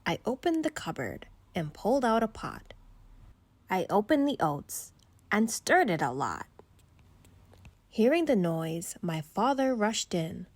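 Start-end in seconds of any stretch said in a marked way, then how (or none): none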